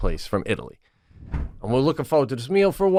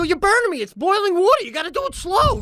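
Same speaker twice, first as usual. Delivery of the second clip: high, loud pained yell; retold yell; 3 dB louder than usual. Retelling yelling at his wife while she pours boiling water. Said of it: "I go".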